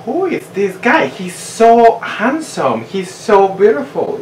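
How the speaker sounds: high-pitched, swooning admiration; smitten gushing. Retold or imitated. Re-enacting first sight of the new date, high gushing. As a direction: gush in a high voice, stretching the praise. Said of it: "I did exactly the same thing, like".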